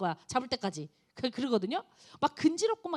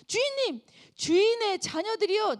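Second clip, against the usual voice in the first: last servant; 8 semitones higher, 4 dB louder than usual. Acted. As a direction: break it haltingly. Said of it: "마지막으로 또 사환이 딱 들어옵니다". (높고 느린 머뭇거리는 종)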